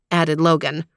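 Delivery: ordinary delivery, nothing marked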